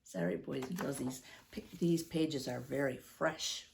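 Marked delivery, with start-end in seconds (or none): none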